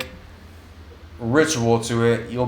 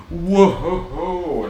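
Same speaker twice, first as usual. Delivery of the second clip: excited whoa; 5 semitones higher than usual. Real, not acted. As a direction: cry out with delight while spinning. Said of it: "I'm spinning in a chair".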